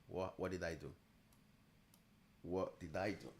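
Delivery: usual speaking voice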